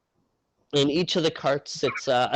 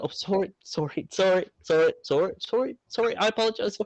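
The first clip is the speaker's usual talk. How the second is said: flustered apologies; 7 semitones higher, faster than usual. Acted. Crewmate apologizing behind party.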